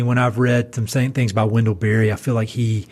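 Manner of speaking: usual speaking voice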